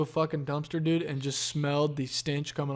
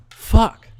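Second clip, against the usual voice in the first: loud curse; 6 semitones higher, 12 dB louder than usual. Real, not acted. Sudden frustrated outburst.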